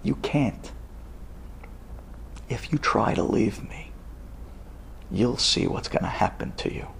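low, soft, menacing warning; hushed threat. Retold or imitated. Acted threat to a lover.

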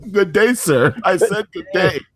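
a hint of a huffy Willy Wonka brushing someone off. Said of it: "Willy Wonka".

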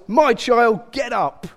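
high, shouted command; loud voice. Acted. Jesus raising the dead girl, loud command. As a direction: call it out loudly with authority. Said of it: "Said in a loud voice".

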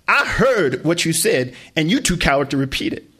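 mother taunting her son; furious mom. Acted. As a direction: dare him to say it again.

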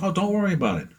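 high, breezy mock reassurance; reassuring Frank. Frank brushing off his worry about oils, high and breezy. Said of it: "He goes".

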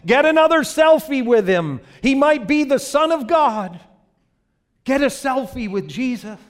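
high, loud excited yell; yelling to girlfriend. Acted. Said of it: "he yells out to his girlfriend".

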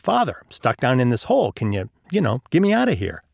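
ordinary delivery, nothing marked

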